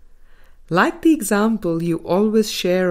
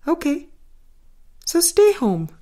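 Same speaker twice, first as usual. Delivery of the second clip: higher, breezy mock reply; easygoing okay; 10 semitones higher than usual. Mother retelling what she tells her sick kids, breezy and higher. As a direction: toss it off lightly, much higher. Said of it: "I have said".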